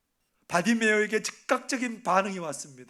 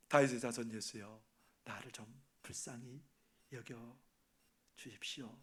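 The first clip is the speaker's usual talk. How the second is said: hushed, much softer plea; quiet pleading; 9 semitones lower, 13 dB quieter than usual. Retold or imitated. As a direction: murmur the plea lower, like a secret prayer. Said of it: "뭐라고 말합니까?". (낮고 작게 속삭이듯 간구)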